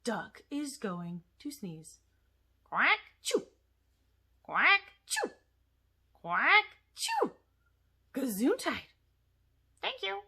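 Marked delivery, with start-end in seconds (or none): 2.6-3.5 quacking sneeze. Acted. Duck puppet sneezes. 4.4-5.3 quacking sneeze. Acted. Duck puppet sneezes. 6.1-7.3 quacking sneeze. Acted. Duck puppet sneezes. 9.8-10.2 puppet voice. Acted. Puppet thanks after sneeze.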